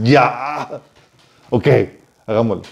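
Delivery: mock exasperation on a drawn-out first word, then giving in; fed-up surrender. Samson fed up with constant nagging, mock exasperated surrender. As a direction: imitate a worn-down man caving in. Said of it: "Sansón se hartó de tanta insistencia".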